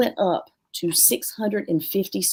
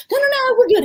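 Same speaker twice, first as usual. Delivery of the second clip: much higher, louder hurried no-no-no; panicking sister; 7 dB louder, faster than usual. Retold or imitated. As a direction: play the sister rushing in to smooth things over. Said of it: "is running behind me like".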